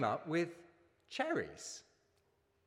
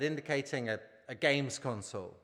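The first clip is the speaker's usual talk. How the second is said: ordinary talk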